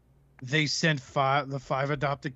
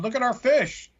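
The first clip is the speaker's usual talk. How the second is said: a bright, higher show-off voice; 8 semitones higher, 5 dB louder than usual. Retold or imitated. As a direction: proudly show off the fish tanks, a touch louder. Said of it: "to show everyone".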